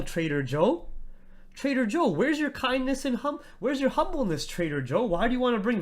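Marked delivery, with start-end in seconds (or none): 1.5-4.5 high, singsong mocking voice; mocking whine. Streamer mocks chatter Trader Joe in a high sneering whine. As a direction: parrot the chatter back in a sneering falsetto.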